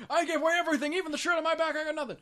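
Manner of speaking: put-on high-pitched old man voice; happy old man. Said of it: "Old man's like".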